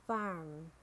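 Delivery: "farm" with a sounded American R; American "farm", curl the R before m; American accent. Shown as a demonstration. Teacher models farm for learners, American accent. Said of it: "R in American English".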